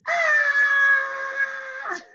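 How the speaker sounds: very high, loud "Ah!" scream; high pained cry. Shown as a demonstration. Hand screams at the doctor, high and loud. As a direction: shriek like the shot really hurt. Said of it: "He says".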